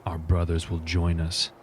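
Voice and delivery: slow, hushed, solemn pronouncement; in-character war leader. Acted. Leader telling warriors of the nightfall plan, grave and slow. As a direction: lay out the plan gravely, unhurried.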